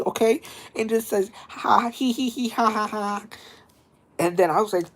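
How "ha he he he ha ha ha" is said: high, spelt-out mock cackle; imitated creepy laugh. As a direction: mimic a scare actor's high cackle. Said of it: "this guy comes up behind me".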